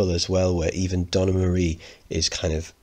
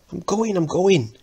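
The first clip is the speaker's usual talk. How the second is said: put-on high flustered boy; 12 semitones higher than usual. Boy fleeing.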